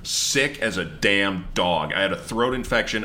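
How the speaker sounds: normal talking voice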